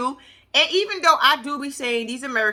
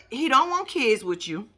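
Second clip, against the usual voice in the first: ordinary talk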